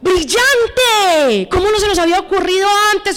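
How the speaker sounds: gushing, rushed office enthusiasm; hammed-up delight at a colleague's idea; mock amazed praise. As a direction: burst out with over-the-top admiration. Acting colleagues praising someone else's idea.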